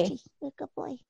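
soft high doggy voice; talking to a dog. Real, not acted. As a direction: coo at the puppy. Owner greets puppy on camera.

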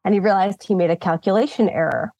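ordinary talk

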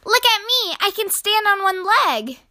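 put-on high, excited child's voice; high-pitched childlike boast; little penguin showing off.

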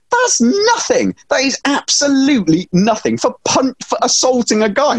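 high-pitched, emphatic disbelief; incredulous protest. Real, not acted. Podcaster scoffing at a tiny fine for assault, incredulous protest. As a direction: pitch leaps up, outraged at how little it is.

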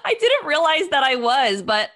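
high laughing protest. Real, not acted. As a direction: laugh through the words. Laughing after teasing.

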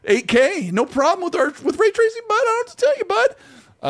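climbing into squeaky-high mock condescension; condescending. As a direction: patronize your buddy, pitch rising absurdly high. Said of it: "our really condescending statement we use".

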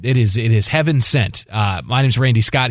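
ordinary talk, nothing marked

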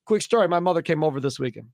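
ordinary talk

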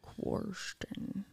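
hushed "Question" as "kworsh-ton"; Southern man's pronunciation. Retold or imitated. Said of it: "but he always said".

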